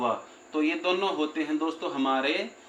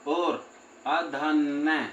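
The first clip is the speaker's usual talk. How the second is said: slow demo; much slower than usual. Shown as a demonstration. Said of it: "जब हम नासिका से उच्चारण करते हैं".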